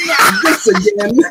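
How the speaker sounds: loud, high, slow mock groan, laughing; exasperated punchline. Priest hearing the boy in confession, loud mock exasperation. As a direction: belt it out slowly, breaking into laughter.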